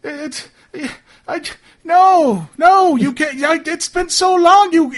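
high, loud, hysterical pleading; frantic captive's wailing outburst; going nuts. Acted. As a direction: lose it completely, crying out. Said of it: "he's going nuts now".

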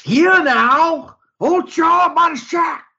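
very loud, high southern holler; drawn-out southern-accented shout; yelled southern accent. Acted. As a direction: bellow across the beach in a southern accent. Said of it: "what was it you yelled?".

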